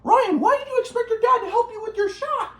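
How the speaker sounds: high, lightly voiced grandpa; mock grandpa. Grandpa asking why he expected help, high mock voice. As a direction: squeak it out like a puzzled old grandpa. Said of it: "my grandpa started to be like".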